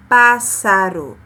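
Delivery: pássaro said slowly and loudly with a sharp s; careful demo word. Shown as a demonstration. Teacher repeating the word for pupils, slow sharp s. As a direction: stretch pássaro, hissing the ss. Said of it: "continuasse tendo o mesmo som".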